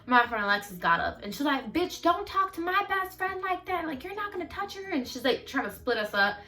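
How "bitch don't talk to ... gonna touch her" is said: angry warning. Retold or imitated. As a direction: imitate a protective friend, pitched up without more volume. Acts friend stepping into fight.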